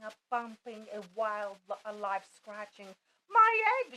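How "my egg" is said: loud, high shout. A narrator's reading. Horton shouting as his egg starts hatching, loud. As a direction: cry out in alarm. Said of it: "shouted Horton".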